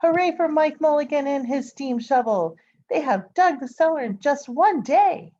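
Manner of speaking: high, loud cheer all the way through; cheering shout. A narrator's reading. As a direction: shout it out with the whole crowd. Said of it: "shouted the people".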